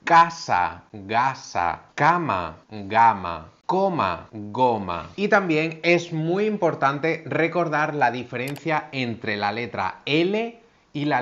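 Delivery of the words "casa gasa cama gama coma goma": teacher minimal pairs k and g; k versus g contrast. Shown as a demonstration. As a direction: contrast each pair carefully. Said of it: "Con la C y con la G".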